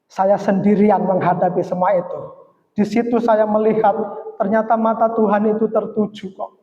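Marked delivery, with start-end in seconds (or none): none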